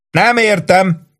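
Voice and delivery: high bellowed question; bellowing. A narrator's reading. Sergeant bellowing down over the noise. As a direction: roar the question down.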